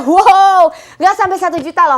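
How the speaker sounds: loud amazed wow; excited. Acted. Host hypes the discount price. (kagum dan bersemangat)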